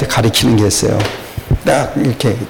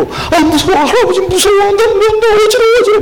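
mock scared grandpa. Grandpa playing scared for his grandchild on the rocking horse. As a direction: playfully pretend to be dizzy and scared. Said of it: "그러면 나는 일부러".

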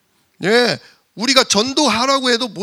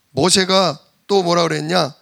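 usual speaking voice

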